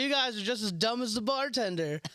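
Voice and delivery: mock roast of the crowd; cheeky jab. Insulting the crowd onstage.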